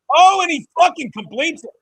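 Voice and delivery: sudden loud, high burst of joy; excited outburst. Real, not acted. Streamer cheering his team's big completion, excited and loud. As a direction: erupt as the pass is caught.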